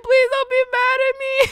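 very high, loud wailing plea; mock terrified plea. Child begging from under the car, mock terrified. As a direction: beg in a hammed-up child's squeal.

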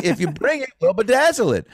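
high mock Houston twang; thick Houston accent. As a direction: promise it as the Houston shop owner, in her accent. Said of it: "someone with a thick accent like that".

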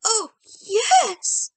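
put-on an old woman pleasantly taken aback; surprised and delighted. A narrator's reading. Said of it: "surprised and delighted".

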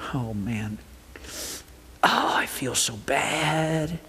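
slow, drawn-out sickly moan; mock self-pity. Believer whose own turn it is to be sick, moaning. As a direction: moan it slowly, hamming up the misery. Acting out feeling sick oneself.

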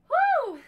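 loud high whoop; cheer. Real, not acted. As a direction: whoop to push through the burn. Cheering mid-workout burn.

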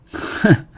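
short voiceless huff; contemptuous snort. Acted. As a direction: push out a breathy, scornful "hmph".

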